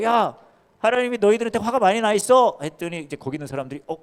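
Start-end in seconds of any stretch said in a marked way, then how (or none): 0.0-2.6 high, loud prophet's warning; shouted warning. Acted. Jonah shouting God's anger at Nineveh, loud. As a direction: call it out to the whole city.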